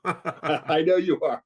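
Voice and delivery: amused agreement, laughing as you speak. Real, not acted. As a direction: chuckle your way through the words. Chuckling agreement with co-host's joke.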